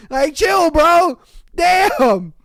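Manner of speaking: loud, high exclamation; amused protest. Retold or imitated. Host telling the Scotsman to chill, loud amused protest. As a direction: call out in comic disbelief.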